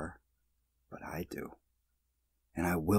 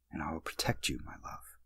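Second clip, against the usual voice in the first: hushed unvoiced whisper; 3 semitones lower, 7 dB quieter than usual. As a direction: whisper the promise close. Whispered promise in dream.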